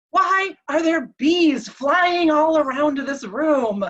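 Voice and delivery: bewildered demand. Retold or imitated. Hallucinating husband seeing bees in the room, bewildered demand. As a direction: stretch out each word in mock bewilderment.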